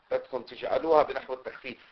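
usual speaking voice

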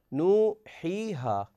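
نوحيها said very slowly with each long vowel held; drawn-out reading. Shown as a demonstration. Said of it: "توفرت في هذه الكلمة". (نوحيها ببطء شديد مع مدّ كل حرف مدّ)

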